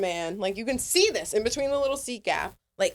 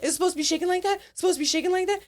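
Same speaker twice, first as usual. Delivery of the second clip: mock nervous flyer; 9 semitones higher, much faster than usual. Imitating the nervous first-time flyer.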